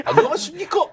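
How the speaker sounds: high, lisping official greeting; Hong Nam-ki impression. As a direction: greet like a prim finance minister, lisping. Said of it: "홍남기 성대모사도 하셨겠습니까?". (혀 짧은 관료 말투로 높게)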